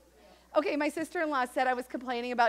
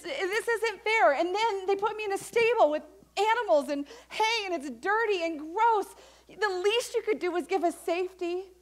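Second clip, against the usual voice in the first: higher, louder whiny protest to God; Mary railing at God, exasperated and high; exasperated complaint; 6 semitones higher, 4 dB louder than usual. Pretend. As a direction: vent like a worn-out young mother at her limit.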